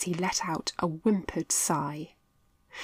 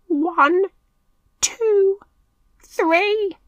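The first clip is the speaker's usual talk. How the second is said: much higher, louder count; tense count; 13 semitones higher, 7 dB louder, much slower than usual. A narrator's reading. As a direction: count down to the run. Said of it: "Mitch counted".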